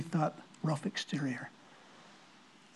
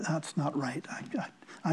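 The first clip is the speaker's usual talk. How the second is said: whisper; 4 semitones lower, 9 dB quieter, slower than usual. Retold or imitated. Inner thought whispered.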